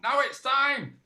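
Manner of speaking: high, loud last orders call; landlord's yell. Acted. Landlord calling time on the arguing drinkers, loud yell. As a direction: call time across a noisy pub. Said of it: "yelled out".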